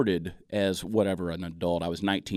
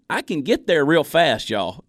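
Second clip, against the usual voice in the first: slow, higher, louder Southern drawl; thick Southern accent; 6 semitones higher, 5 dB louder, slower than usual. Shown as a demonstration. Comedian showing off how Southern he can get, thick Southern accent. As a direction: stretch it out in a broad Southern accent. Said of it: "Now, my Southern accent".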